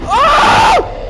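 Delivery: high startled yelp. Real, not acted. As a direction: jump at a sudden scare. Sudden scare.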